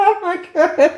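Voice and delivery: spoken through laughter; laughing while talking. Real, not acted. Laughing after joke.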